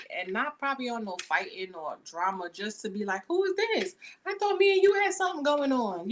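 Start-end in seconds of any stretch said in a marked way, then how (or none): 3.3-6.1 jealous confrontation. Retold or imitated. Jealous stalker confronting you.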